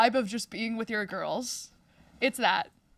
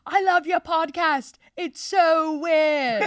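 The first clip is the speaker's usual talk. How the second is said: bad Irish accent, high and loud; a clumsy try at an Irish accent; 6 semitones higher, 6 dB louder, slower than usual. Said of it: "I can't do an Irish accent".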